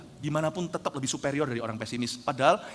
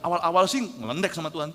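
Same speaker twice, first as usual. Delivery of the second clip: ordinary talk, nothing marked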